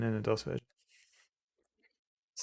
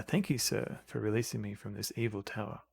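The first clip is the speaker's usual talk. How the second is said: quiet, soothing voice; 2 semitones lower, 9 dB quieter than usual. A narrator's reading. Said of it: "begins to speak in a quiet, soothing voice".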